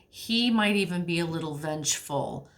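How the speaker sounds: ordinary talk, nothing marked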